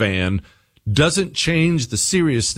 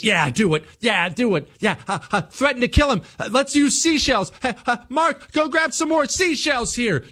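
higher, eager egging on; 9 semitones higher than usual. Retold or imitated. Beach friends urging Comey on, higher eager voice. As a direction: cheer them into it, rising higher. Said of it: "All you get is".

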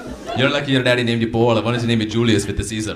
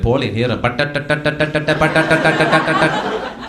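imitating dad's Indian accent as Morse-code gibberish, higher and laughing; mock Indian accent; 4 semitones higher, 3 dB louder than usual. Comic mocking a dad's Indian accent as Morse code. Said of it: "they never get the accent right".

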